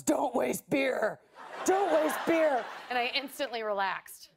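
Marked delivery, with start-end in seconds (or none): 0.0-1.2 slow, drawn-out words to calm a friend down; slow calming quote. Retold or imitated. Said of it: "He goes". 1.6-2.6 slow, drawn-out words to calm a friend down; slow calming quote. Retold or imitated. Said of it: "He goes".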